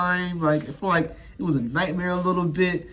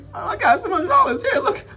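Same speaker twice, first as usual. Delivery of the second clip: high, squeaky, half-laughing plea; mock pleading; 14 semitones higher than usual. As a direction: act out a desperate offer in a tearful squeak. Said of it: "I'm over begging, begging, pleading".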